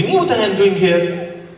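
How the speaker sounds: high, slow broken English; broken English tourist. Acted. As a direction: play a confused foreign tourist. Acted traveler with accent.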